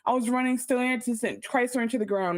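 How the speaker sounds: high babyish whine, playing innocent; mock baby CEO. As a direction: pout like a helpless baby. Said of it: "make it seem like".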